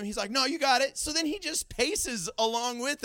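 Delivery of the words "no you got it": louder, higher breezy refusal; lightly voiced lifeguard waving off help; lifeguard calling out. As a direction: call it back casually, raised voice. Lifeguard waves off request for help.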